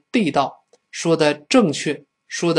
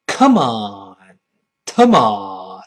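loud, drawn-out falling bursts; angry comparison; 5 semitones lower, 5 dB louder, much slower than usual. Acted. Comparing foreign and Chinese anger, two angry exclamations. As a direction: burst out crossly twice, dropping low. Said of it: "老外一生气说".

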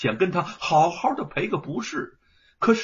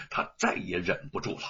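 usual speaking voice

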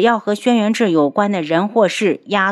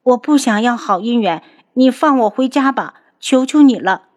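put-on higher, timid girl's voice, begging; timid girl pleading; 5 semitones higher, 3 dB louder than usual. Captive girl begs the doctor to release her, timid and higher. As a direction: beg to go home, small and frightened. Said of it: "一个怯生生的女声响起来".

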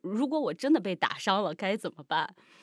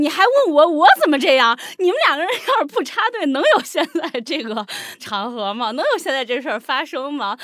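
loud, high-pitched ranting; retold indignant retort; 6 semitones higher, 11 dB louder than usual. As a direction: fire back in hammed-up outrage. Re-enacts indignant inner retort.

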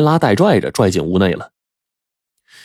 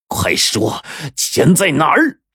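angry roar; 6 semitones higher, slower than usual. A narrator's reading. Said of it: "冲着肥仔就怒吼".

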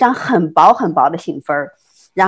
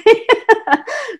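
high laugh; 4 dB louder than usual. Real, not acted. Laughs at own story.

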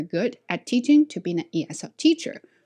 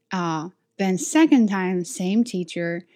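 usual speaking voice

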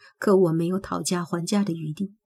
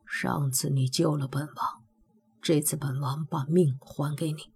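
softer, murmured close; low murmur; 3 semitones lower, 5 dB quieter than usual. Acted. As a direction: murmur it close to her face. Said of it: "低语".

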